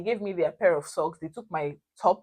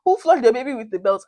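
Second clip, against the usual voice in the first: louder, higher, indignant repeat of the question; outraged echo; 5 semitones higher, 8 dB louder than usual. Retold or imitated. Echoing the friend's accusation back at her, outraged. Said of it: "how bad can this day get".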